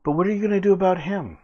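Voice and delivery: high, quick character voice; high-pitched quick challenge; Kellis taunting. Acted. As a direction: toss out a high, fast question. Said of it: "takes another step back and goes".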